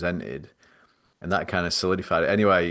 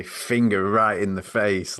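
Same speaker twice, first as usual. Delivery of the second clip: normal talking voice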